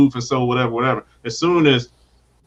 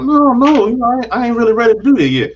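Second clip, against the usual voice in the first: high, louder, fast playful no; mock coy refusal; 7 semitones higher, 6 dB louder than usual. Girl teasingly refusing a guy, high fast put-on voice. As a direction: play hard to get in a put-on voice.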